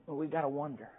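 ordinary delivery

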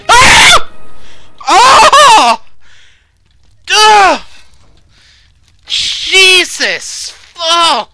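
high, loud, startled screaming; terrified screams. Real, not acted. Gamer jolted by the pizza oven demon, screaming in fright. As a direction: shriek in real panic at a sudden fright.

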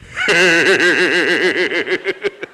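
a short giggle before the hit; nervous giggle. Acted. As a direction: giggle as you brace for impact. Kid laughing before impact.

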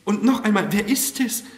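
high, loud, insistent question; agitated questioning. A narrator's reading. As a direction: press anxiously for the answer. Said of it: "mit unruhig arbeitender Brust".

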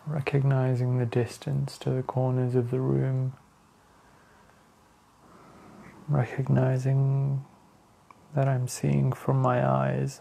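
slow, even meditation voice with long pauses; guided meditation. Real, not acted. Leading listeners to the breath, calm meditation voice. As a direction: guide attention inward, unhurried and steady.

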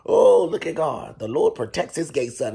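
"Oh, look at God" stretched out loud and slow; loud slow praise. Retold or imitated. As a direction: praise God with a big, drawn-out voice. Greeting bodyguard, repeated louder.

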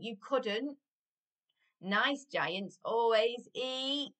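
lively, emphatic rhyming storytime voice; story reading to children. A narrator's reading. Adult reading a giant story to children, animated storytelling. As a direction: read it out with bouncing, punched words. Reads picture book to children.

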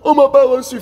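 mocking a tearful complainer; mock crying. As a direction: whine and bawl like someone rejected, hammed up. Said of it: "tu pleures".

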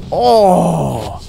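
high, loud, drawn-out "oh"; long falling cry of reaction. Real, not acted. Player reacting mid-fight, loud cry.

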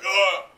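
loud, high pained grunt. Retold or imitated. Sasuke grunts, coughing up blood. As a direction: grunt like you've been hit hard. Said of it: "He's like".

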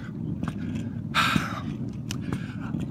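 long, soft unvoiced sigh; weary sigh of sadness; heavy sigh. Real, not acted. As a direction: let out a sad, tired breath. After leaving friend.

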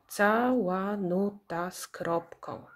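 "Cała nuta z kropką" slow, in six even beats; syllable by syllable. Shown as a demonstration. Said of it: "ilość sylab w nazwie pokrywa się".

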